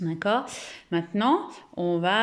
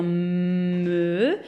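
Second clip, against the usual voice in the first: very slow sounding out; 3 semitones lower than usual. Shown as a demonstration. Teacher to pupils, drawing out mi very slowly. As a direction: drag every sound of pour écrire mi. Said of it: "je prends".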